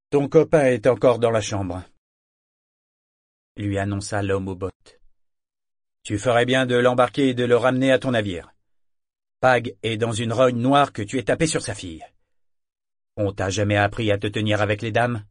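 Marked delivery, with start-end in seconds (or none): 0.1-1.8 annonce fort et sèchement, plus fort; voix de marin bourru qui porte; rudoiement sonore. Acted. L'homme aux bottes rudoie Althea. 6.0-15.2 annonce fort et sèchement, plus fort; voix de marin bourru qui porte; rudoiement sonore. Acted. L'homme aux bottes sermonne Althea.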